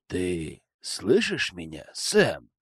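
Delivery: slow, soft coaxing question; insinuating. Acted. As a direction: coax him gently and slowly. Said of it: "вкрадчивым голосом".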